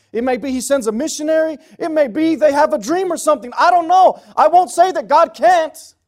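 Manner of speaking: high, loud, insistent sermon voice; emphatic, impassioned pulpit cry; full-force preaching. Real, not acted.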